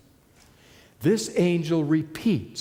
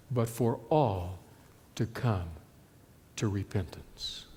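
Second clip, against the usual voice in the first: fading soft; 8 semitones lower, 7 dB quieter than usual. Acted. Preacher lets plea fade away.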